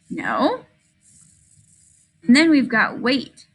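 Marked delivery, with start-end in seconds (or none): 0.1-0.7 No. asked softly with a rise; questioning no. Shown as a demonstration. Teacher demoing question mark, softly questioning. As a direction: ask the word No. softly as a question. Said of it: "This one has a question mark".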